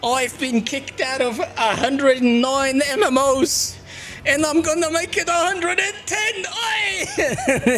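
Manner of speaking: high, slow mock Yiddish voice with laughs; yiddish accent. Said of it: "sit here on stream going".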